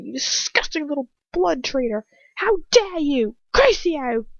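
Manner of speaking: high-pitched, venomous outburst; furious. A narrator's reading. Bellatrix cursing Ron as she raises her wand, furious. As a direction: build in fury up to a loud cast spell. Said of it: "raises her wand furiously".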